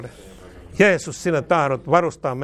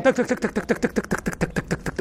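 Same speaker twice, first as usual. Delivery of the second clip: fading tongue clicks; 5 semitones higher, 6 dB quieter than usual. Real, not acted.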